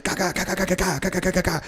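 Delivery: soft quick ga ga ga, like a jammed gun; imitated gunfire clicks. Husband's empty gun sputtering, soft rapid ga sounds. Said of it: "he just".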